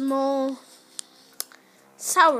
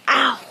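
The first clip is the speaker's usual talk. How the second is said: sharp loud yelp of pain; yelp, caught by surprise; sudden ow; 9 dB louder than usual. Real, not acted. Sat on the candy box.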